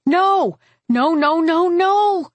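high, anguished cries of denial; rising wail of horror; horrified cry. A narrator's reading. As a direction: cry out as a friend dies before you. Narrator sees Adora burn.

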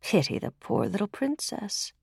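soft, pitying servant's murmur; hushed sorrow for someone else; sorrowful whispers. A narrator's reading. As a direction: say it softly, full of pity. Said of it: "said with sorrow in their eyes".

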